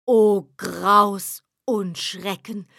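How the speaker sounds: slow, dark dramatic gasp of dread; mock horror. A narrator's reading. Narrator announces the devil's arrival, slow and ominous. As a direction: intone it like a ghost story.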